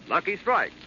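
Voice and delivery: ordinary talk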